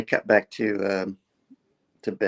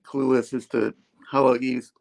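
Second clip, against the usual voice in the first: ordinary delivery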